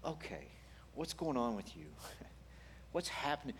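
soft, tender questioning; hushed, gentle concern; gentle whisper. Acted. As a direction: ask softly, inviting them to talk. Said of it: "the nature of the gentle whisper of God".

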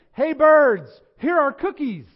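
full-voiced, high holler; loud shout. A narrator's reading. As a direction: shout it out to the birds far away. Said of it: "shouted in a loud voice".

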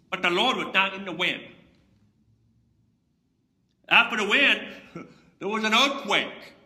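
loud, building sermon voice with big emphasis; fiery preacher's storytelling; preaching at full force. Acted. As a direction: thunder out the storm and the fire to the congregation. Preacher retelling Elijah's storm.

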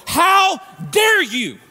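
loud, raging threat to the sinner; furious Jesus. Acted. Jesus confronting those who dishonored his Father, furious shout. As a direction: thunder it out as an outraged son defending his father. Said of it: "for Jesus to go".

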